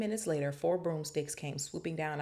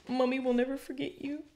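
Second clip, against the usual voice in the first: sobbing Hagrid; 9 semitones higher than usual. A narrator's reading. Hagrid sobbing goodbye to his baby dragon, high mock wail. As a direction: wail it like a parent losing a baby. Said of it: "Hagrid sobbed".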